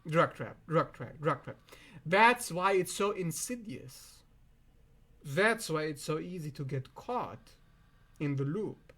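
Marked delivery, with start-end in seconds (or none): none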